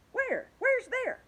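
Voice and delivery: sudden high alarmed question; startled high cry. Acted. The creatures cry out to the grasshopper, high and startled. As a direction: squeak it out in alarm.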